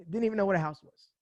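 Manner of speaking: normal talking voice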